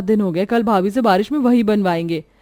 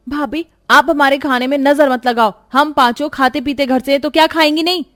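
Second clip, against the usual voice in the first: quarrelsome retort; 7 semitones higher, 4 dB louder than usual. Acted. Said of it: "अपनी भाभी से लड़ने लग जाती है".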